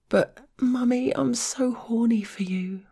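high, breathy begging voice; whiny pleading girl. A narrator's reading. Whiny lover begs.

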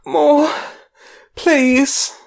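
high, loud gasping plea; loud, breathless begging; gasped plea. A narrator's reading. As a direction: gasp it out urgently, pitched right up. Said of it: "I hear her gasp out".

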